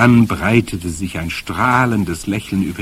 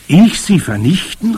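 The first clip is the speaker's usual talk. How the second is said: high-pitched, startled question; incredulous cry; 11 semitones higher, 6 dB louder than usual. A narrator's reading. Boy learns he must destroy witches, incredulous cry. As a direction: cry out in disbelief. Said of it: "rief ich".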